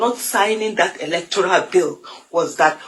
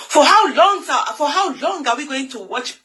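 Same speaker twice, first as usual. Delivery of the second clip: rising outcry; 5 semitones higher than usual. Real, not acted. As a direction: cry it out, pleading. Repeated question, building up.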